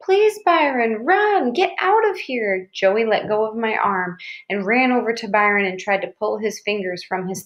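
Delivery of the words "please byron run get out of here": high, slow, drawn-out plea; alarmed little girl imploring; frantic pleading. A narrator's reading. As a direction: beg him to run, stretching the words. Frightened sister begs brother to run.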